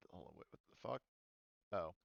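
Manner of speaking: ordinary talk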